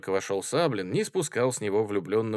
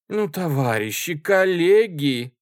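raised, louder plea, drawn out at the end; pleading; 8 semitones higher, 8 dB louder, slower than usual. A narrator's reading. Chairwoman begging her colleagues for order, pleading. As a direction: implore the group, stretching the last word. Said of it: "умоляюще сказала Варя Мартова".

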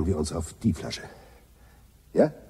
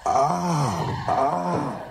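outraged cry; 5 semitones higher than usual. Acted.

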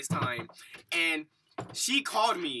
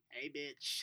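softly voiced, offhand taunt; creature's cool greeting; 3 semitones lower, 14 dB quieter than usual. Creature in the shoe greets him, soft and cool. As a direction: say it quietly, as the cheeky critter.

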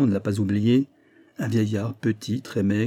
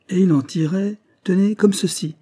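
high, louder build to a reveal; spring it on the listener suddenly; dramatic reveal; 9 semitones higher, 5 dB louder than usual. A narrator's reading. Maurice springs the spider on him.

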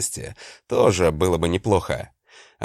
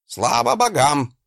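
barking; 8 semitones higher than usual. Acted. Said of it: "гаркнул Гринольф".

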